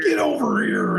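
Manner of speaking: high shouted battle cry; Scorpion voice. Retold or imitated. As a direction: yell it like the game character. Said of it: "Scorpion would be like".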